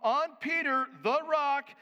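loud, high preaching voice. Acted. Preacher to his congregation, forceful preaching.

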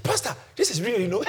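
imitating giddy excitement, laughing and high; breathless-with-joy impression climbing very high; excited. Healed churchgoer telling her pastor the news, excitedly imitated. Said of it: "She was excited because it's happened".

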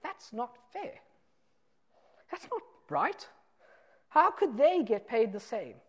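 high, whiny voice of a resentful worker; mock outrage, pitched high and complaining; indignant complaint. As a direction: play the aggrieved worker protesting. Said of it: "you would think to yourself".

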